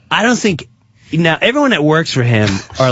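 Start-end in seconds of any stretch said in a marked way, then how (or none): none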